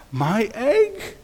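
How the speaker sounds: high, startled cry; shouted astonishment. A narrator's reading. Horton hears his egg scratching, astonished shout. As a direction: shoot the pitch up in disbelief. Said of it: "shouted Horton".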